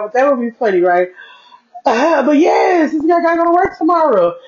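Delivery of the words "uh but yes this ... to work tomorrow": high, half-laughing mock cry about work; playful fake sob, pitched high; mock wail. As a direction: whine about having to work tomorrow, hammed-up. Complaining about work tomorrow.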